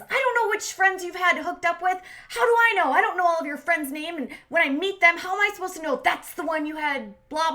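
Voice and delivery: high, loud imitation of exasperated ranting; mock outrage, piling up complaints; irate rant. Retelling girlfriend's fight over friends, irate rant. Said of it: "Stephanie is irate".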